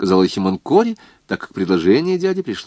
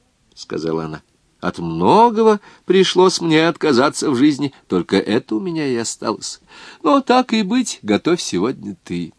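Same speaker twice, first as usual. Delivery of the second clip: put-on gentle old woman; 4 semitones higher than usual. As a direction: hand it over like a wise grandmother. Old woman gives kitchen over.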